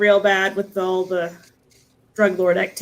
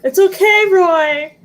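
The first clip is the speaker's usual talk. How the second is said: cooing to a puppy; 8 semitones higher, 4 dB louder, slower than usual. Retold or imitated. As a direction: coo it like soothing a nervous puppy.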